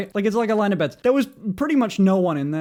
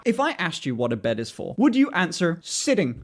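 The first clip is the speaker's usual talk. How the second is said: ordinary delivery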